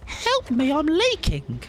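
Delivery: silly high little voice begging; mock cry for help. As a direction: squeak a hammed-up plea for help.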